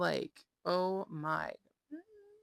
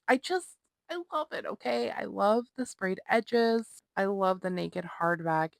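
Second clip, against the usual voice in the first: excited gushing; 4 semitones higher, 4 dB louder than usual. Real, not acted. Gushing over favorite book edition.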